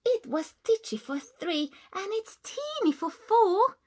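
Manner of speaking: put-on high, loud, desperate old lady; crying old lady. Old lady begging the animals to stop, desperate. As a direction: cry out in despair. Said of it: "The little old lady cried".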